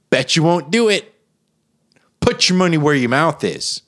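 challenging; loud dare. Acted. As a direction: dare them loudly. Daring the ad.

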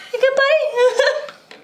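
slow, very high, careful question; high-pitched check-in. Acted. Player squeezes drinking friend's shoulder, high slow check-in. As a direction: squeak it out slowly, checking on a buddy.

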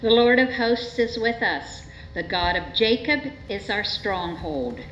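normal talking voice

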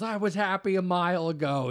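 high-pitched comic whine of blame; Sebastian Maniscalco. Retold or imitated. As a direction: whine it like a wronged driver. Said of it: "he goes".

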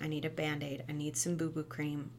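ordinary talk

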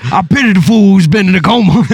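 a friend doing Mister T after waking from a coma, loud and hammed-up; Mister T impression. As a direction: imitate Mister T for a laugh. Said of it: "like Mister T waking up".

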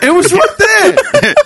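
very high, drawn-out, through laughter; slow it down in laughing disbelief; real laughing exasperation, pitched right up; laughing outrage. Real, not acted.

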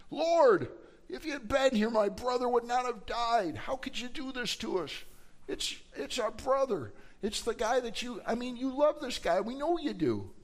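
high-pitched anguished pleading; distraught plea. Acted. Mary at Jesus' feet over her dead brother, distraught. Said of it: "She is distraught. She is in anguish".

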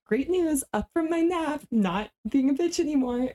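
high, bubbly mock-cheerful voice; cheery friend impression. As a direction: chirp it like a sunny wake-up call. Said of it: "you called me and you were like".

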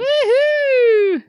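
high, loud whoop of delight; excited cheer. Real, not acted. Host cheering the birthday segment, loud high whoop. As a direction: cheer the jingle on with a squealing laugh.

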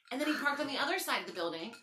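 ordinary talk

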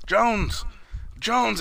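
imitating a boss calling out; high-pitched summons, name by name; calling for him. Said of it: "the boss calls for him".

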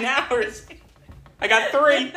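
high, laughing disbelief. Real, not acted. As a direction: laugh through the words in disbelief.

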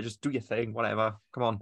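bored. Real, not acted. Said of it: "you could sound a bit excited".

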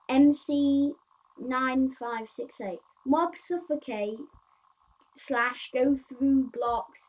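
slow, loud bug-list read-out; reading aloud. Acted. Player reading bug numbers and fixes to viewers, reading aloud. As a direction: read each item out loudly, drawing it out.

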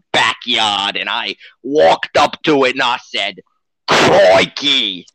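broad put-on Aussie storyteller accent; mock Australian accent. Co-host tells a kangaroo story in a mock Australian accent. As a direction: spin a yarn like a cartoon Aussie. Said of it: "he's Australian, if you can't tell".

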